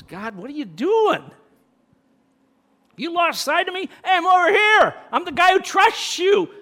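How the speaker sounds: high, loud pleading cry to God; mock complaint to God. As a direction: shout it up to heaven like you're overlooked. Preacher acts out complaint to God.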